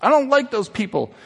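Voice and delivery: a hint of a kid making excuses; lightly voiced son. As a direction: protest as the reluctant son. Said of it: "He goes".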